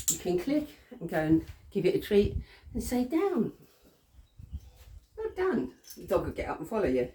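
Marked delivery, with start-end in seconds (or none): 3.0-5.7 high, cheerful "Down. Well done."; happy praise. Shown as a demonstration. Trainer praising the dog after it lies down, high and happy. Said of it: "it's all happy and all nice".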